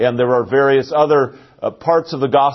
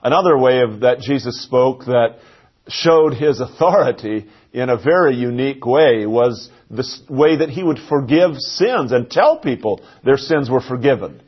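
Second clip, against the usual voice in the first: ordinary talk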